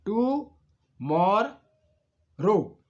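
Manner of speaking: टू मोर रो as three separate beats with long pauses; split into syllables. Shown as a demonstration. Teacher showing three syllables, टू मोर रो said one by one. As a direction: isolate each syllable of टू मोर रो, stepping down in pitch. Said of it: "अब तीन syllable देखिए".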